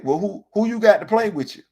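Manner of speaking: ordinary delivery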